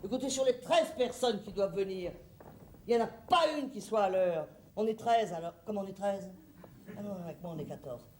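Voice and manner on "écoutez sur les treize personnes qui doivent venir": usual speaking voice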